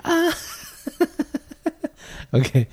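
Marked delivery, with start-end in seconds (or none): 0.0-0.4 shrill roller coaster scream; high pitched yell. Shown as a demonstration. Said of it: "such a manly yell".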